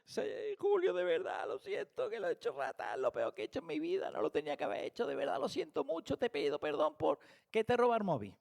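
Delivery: hammed-up tearful begging, squeaking up in places; mock crying apology. Thief apologizing to Julio for the stolen phone, hammed-up weepy pleading. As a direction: play a thief whimpering out a sorry. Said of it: "Incluso llorando".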